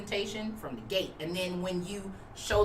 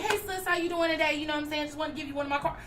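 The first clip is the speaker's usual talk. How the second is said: much higher, upbeat hey sis; a chummy higher street-friendly pitch; casual friendly greeting; 7 semitones higher, faster than usual. Shown as a demonstration. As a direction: greet her like a friend you just spotted. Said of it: "some people you can walk up and be like".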